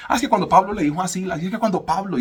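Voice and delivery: high, loud, casual youth voice; mock the young crowd talking about Pablo; mocking youngsters. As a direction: ham up a flippant youngster. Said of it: "las nuevas generaciones decir".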